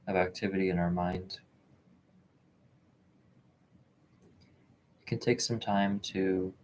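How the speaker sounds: calm, measured meditation voice with long pauses; unhurried, even delivery, leaving space between phrases; guided meditation. Real, not acted. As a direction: gently guide listeners into stillness, phrase by phrase.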